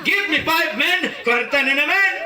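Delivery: high put-on American cheer; mock American high five. American man cheering the free meal, high put-on accent. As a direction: celebrate like a buddy.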